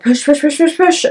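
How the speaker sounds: urging push. Retold or imitated. Midwives urging pushes.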